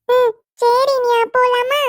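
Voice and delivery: put-on high, slow little girl asking why she's sulking; little child voice. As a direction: play a small child coaxing her aunt. Child agrees to go out. (உயர்ந்த குழந்தைக் குரல்)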